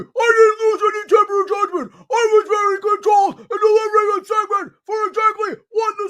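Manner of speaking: high, loud mocking falsetto. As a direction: parody her as an indignant squealing woman. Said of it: "That's how she sounds".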